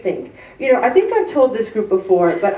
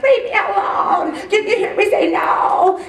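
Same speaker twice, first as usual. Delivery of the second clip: high, strained mock yell; hammed-up anger, pitched way up; exasperated outburst; 10 semitones higher than usual. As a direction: snap after being asked too often. Acts out losing patience.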